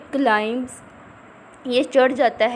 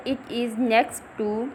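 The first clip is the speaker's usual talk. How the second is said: slow reading aloud; much slower than usual. Shown as a demonstration. Teacher reads text slowly.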